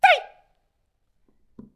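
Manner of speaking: 极高极响的一声断喝; 猛地尖声喝住老虎; 高声大喝. Acted. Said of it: "高声喊嚷". (高声喊嚷，一字喝出)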